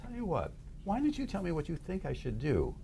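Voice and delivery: soft, high, coaxing voice; acted quiet, reasonable offer; neutral questioner. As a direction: gently invite the inner critic to advise. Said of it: "if you remain neutral and you turn to your negative voice".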